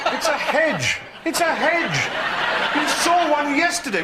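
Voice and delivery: loud, high-pitched comic scolding; mock exasperation. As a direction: berate a silly horse in disbelief. Exasperated rider scolds nervy horse.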